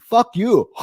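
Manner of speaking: high, loud mock retort. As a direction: fire it back playfully. Imagined heckler retort.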